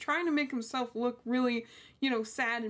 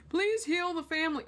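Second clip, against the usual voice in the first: higher, whiny mock prayer; mocking fake plea; 7 semitones higher than usual. Ridiculing the killer's courtroom words, in a higher mocking whine. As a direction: parody the killer's pitiful plea. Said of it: "sitting there going".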